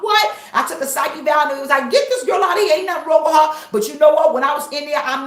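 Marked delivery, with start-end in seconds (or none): 0.0-0.4 sudden high loud what; shocked what. Retold or imitated. She reacts in shock to the officer. 1.9-3.7 louder, higher mock staff voice; loud dismissive quote. Staff shouting to get her out.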